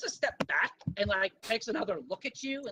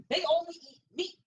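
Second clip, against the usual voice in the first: mildly eager, higher pitch; a touch of keen enthusiasm, pitched up; enthusiastic; 6 semitones higher than usual. Acted. Small girl nodding along to the ferret purchase, enthusiastic. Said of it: "She nods enthusiastically and says".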